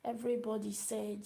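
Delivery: normal talking voice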